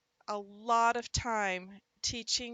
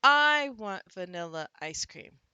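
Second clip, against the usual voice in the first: "I" punched long and very loud, the rest falling away; stress on I; 9 dB louder than usual. Shown as a demonstration. Teacher has students practice a sentence, stress punched on "I". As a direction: hit the word "I" hard. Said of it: "We're gonna punch this one".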